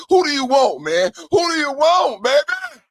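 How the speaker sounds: high, loud hype call; hyped shout. Real, not acted. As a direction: fire up the audience. Pumped rhetorical hype question.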